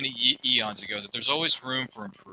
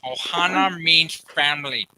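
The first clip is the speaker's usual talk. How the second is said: slow cartoon-film catchphrase impression; slow, louder put-on character voice; movie character impression; 2 semitones higher, 5 dB louder, slower than usual. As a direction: imitate the film line, drawn out and loud. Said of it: "they did an impression on camera".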